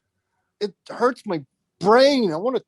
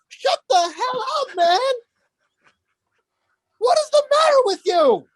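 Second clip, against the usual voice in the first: exasperated rant; 12 semitones higher than usual. Real, not acted. Ranting about the stereotype.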